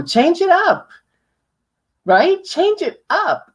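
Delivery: high, swooping pitch that falls off at the end; varied inflection. Shown as a demonstration. Coach demonstrating inflection to audience, high swooping voice. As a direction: lift "change it up" way up and let it drop. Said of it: "changing your inflection".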